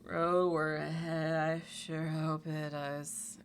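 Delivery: slow, weary mock recital; slow fed-up quote; tired quote. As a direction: drag it out, bored. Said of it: "we were like".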